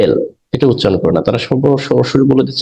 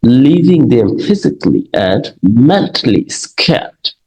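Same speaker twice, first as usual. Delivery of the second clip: teacher reading an English example, very slow; much slower than usual. Shown as a demonstration. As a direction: read each English word out slowly and deliberately. Teacher reads example sentence slowly.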